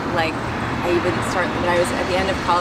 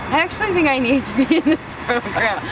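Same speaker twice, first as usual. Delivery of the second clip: higher-pitched retold outburst; mock-urgent, pitched up; sudden plea; 7 semitones higher than usual. Recounting a breakdown to the podcast host, mock-urgent plea. Retelling her change of heart, acted.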